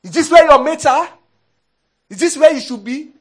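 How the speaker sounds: loud, high belittling questions; challenging. Acted. Fear challenging someone's place, loud demoralizing scolding. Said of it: "it exercises its strength by challenging you".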